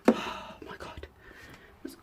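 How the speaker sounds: exasperated whisper. Real, not acted. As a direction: whisper it in disbelief. Exasperated aside about cleaning.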